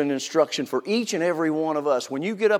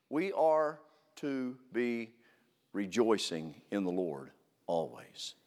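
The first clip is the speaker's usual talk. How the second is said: hushed emphasis; 6 semitones lower, 8 dB quieter than usual. Real, not acted. Sermon point drops to hush.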